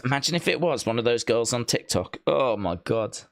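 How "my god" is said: normal talking voice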